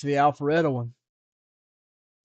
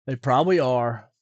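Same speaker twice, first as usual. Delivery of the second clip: ordinary talk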